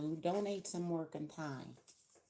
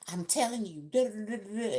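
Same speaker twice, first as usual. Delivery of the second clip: imitated lecturing old lady; 5 semitones higher, 7 dB louder than usual. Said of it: "This old lady".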